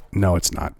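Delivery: low, soft, deadpan "No, it's not"; flat denial. Real, not acted. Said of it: "half of this bill truly is about".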